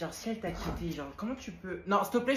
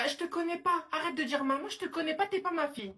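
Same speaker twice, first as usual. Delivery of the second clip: indignant denial; 7 semitones higher than usual. Pretend. Mother disowns daughter on phone.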